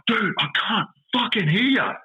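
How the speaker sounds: high, slow, stretched-out yell; shouting over noise. Retold or imitated. To the excavator driver over engine noise, a high drawn-out yell.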